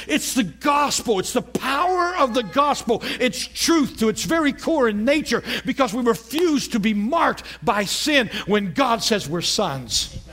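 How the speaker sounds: slow, high, half-sung preaching; preaching at full force. Real, not acted. Pastor preaching the gospel's power to the congregation, half-sung. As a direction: lift it like a sung climax.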